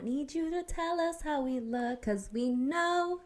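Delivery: sweet high storybook princess; sing-song it like a cartoon princess; Disney princess voice. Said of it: "That was my Disney princess voice".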